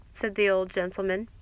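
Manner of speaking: ordinary delivery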